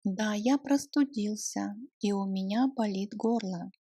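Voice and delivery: ordinary delivery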